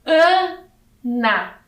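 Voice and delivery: syllable by syllable. Shown as a demonstration. Teacher reading a little word to children, syllable by syllable. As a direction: read "Ana" slowly with a gap inside. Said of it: "Vamos fazer a leitura dessa palavrinha".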